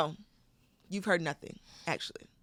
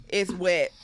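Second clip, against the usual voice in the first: ordinary delivery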